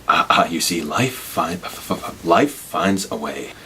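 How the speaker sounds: halting, stuttering movie-scientist impression; hammed-up hesitant, drawn-out delivery; bad Jeff Goldblum. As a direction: stammer softly, then stretch the phrase out slowly. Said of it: "that's my bad Jeff Goldblum impression".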